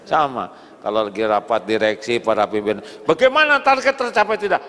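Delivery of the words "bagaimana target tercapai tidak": high, loud, fast demand; demanding boss. Pretend. Director demands results at a board meeting, loud and fast. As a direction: grill the staff about targets.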